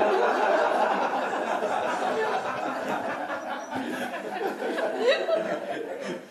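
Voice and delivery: a long, airy, high laugh; delighted high laughter; long high giggle. Real, not acted. Long laugh after the punchline.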